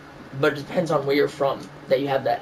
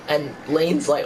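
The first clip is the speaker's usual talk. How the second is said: ordinary talk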